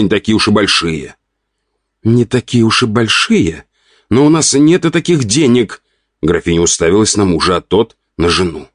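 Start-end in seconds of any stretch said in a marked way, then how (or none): 2.0-3.7 slow, disbelieving question, pitched up; drawn-out indignant query; incredulous echo. A narrator's reading. Wife echoes his words indignantly. 4.1-5.8 high-pitched indignant objection; protesting. A narrator's reading. Wife protests to her husband.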